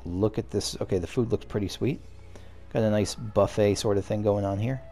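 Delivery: ordinary talk, nothing marked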